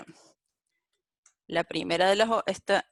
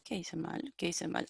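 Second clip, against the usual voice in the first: much softer, puzzled self-talk; muttering to oneself; 12 dB quieter than usual. Real, not acted. Teacher hunting for own coding mistake, muttering to oneself.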